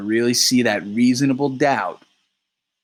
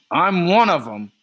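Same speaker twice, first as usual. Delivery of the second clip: loud, higher, raging snap back; 7 semitones higher, 5 dB louder than usual. Acted. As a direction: fire it right back in a rage.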